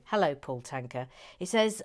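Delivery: ordinary talk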